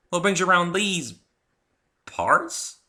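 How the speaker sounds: high, loud country drawl; friendly southern farm mare greeting; Applejack's voice. Acted. Applejack speaks.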